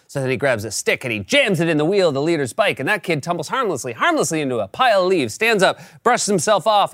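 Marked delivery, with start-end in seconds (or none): none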